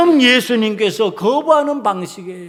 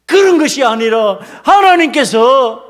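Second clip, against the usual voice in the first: usual speaking voice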